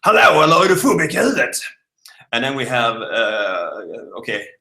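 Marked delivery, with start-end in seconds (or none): 0.0-1.6 loud, higher southern Swedish accent. Shown as a demonstration. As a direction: demonstrate a broad southern Swedish accent loudly. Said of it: "this is the very southern part".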